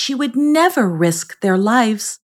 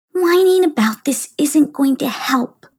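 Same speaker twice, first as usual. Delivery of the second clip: high inner voice; 9 semitones higher than usual. Acted. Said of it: "she thought to herself".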